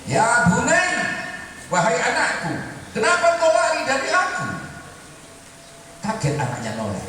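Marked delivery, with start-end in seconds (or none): 0.0-4.5 high calling cry to his son; pocong calling out. Retold or imitated. Ghostly father calls after his fleeing son, raised cry. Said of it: "si pocong teriak".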